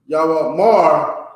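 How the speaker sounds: "Yahweh Mar" drawn out slowly, stress landing on "Mar"; slow example word. Shown as a demonstration. Teacher reading the lesson's example word aloud, slow and careful. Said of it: "as in Yah".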